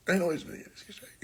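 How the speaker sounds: fast, under the breath; whispered aside. Real, not acted. Talking to viewers about the woman, whispered.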